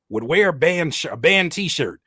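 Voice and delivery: usual speaking voice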